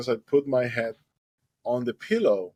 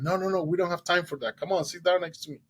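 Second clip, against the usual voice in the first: acted hurried brush-off, higher pitch; urgent refusal; 7 semitones higher than usual. Boat owner refuses the swimmer's bucket offer, urgent refusal. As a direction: wave the offer away urgently.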